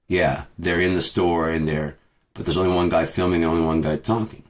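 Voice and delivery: normal talking voice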